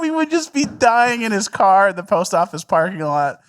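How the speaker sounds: high, loud laugh-filled telling; laughing while talking. Real, not acted. Recalling pranks in the car, laughing hard. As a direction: push the words out through laughter.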